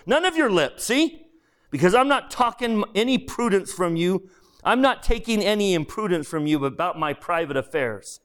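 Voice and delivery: high-pitched, huffy ghost; indignant ghost. Acted. Ghost rebukes the other over private affairs, indignant. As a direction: put the upstart firmly in his place.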